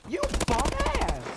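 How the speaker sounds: high, loud gamer insult; outraged yell. Real, not acted. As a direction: yell it indignantly at the enemy. Yells at an enemy in game.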